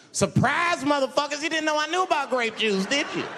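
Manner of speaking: high-pitched, crowing gotcha; gloating reveal. Acted. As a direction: pounce on it and keep the pitch up. Gloating after revealing the secret.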